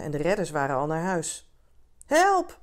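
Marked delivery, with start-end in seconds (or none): 2.1-2.6 very high, loud shout; cry for help. A narrator's reading. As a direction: yell for rescue from far out at sea. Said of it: "hoorde ik roepen". (hoge, luide noodkreet)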